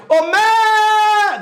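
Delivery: high, long-held "mé"; calling out the syllable. Shown as a demonstration. Teacher showing the tonic syllable lasts longer, calling out. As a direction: holler it, lingering on "mé". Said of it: "Demora mais tempo sendo chamada".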